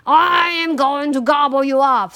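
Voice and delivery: loud troll threat, swelling to the end; menacing troll. A narrator's reading. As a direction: threaten loudly as a hungry troll. Troll threatens the big goat.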